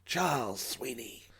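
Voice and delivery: put-on slow self-introduction in an American accent; lightly voiced American pilot; Sweeney's American accent. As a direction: give "Charles Sweeney" like a suave American flyer. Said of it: "with a strong American accent".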